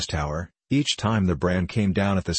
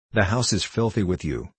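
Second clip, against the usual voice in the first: plain reading voice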